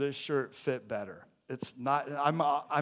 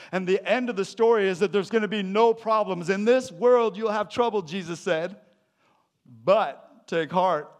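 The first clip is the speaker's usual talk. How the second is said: preaching at full force; 6 semitones higher, 7 dB louder than usual. Acted. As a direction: build it up to the congregation. Preaching the climax of the story.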